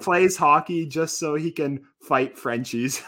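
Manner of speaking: Canadian accent. Shown as a demonstration. Reading the meme on request, slow Canadian accent. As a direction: read it out slowly, thick on the Canadian. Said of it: "do the whole meme in your accent".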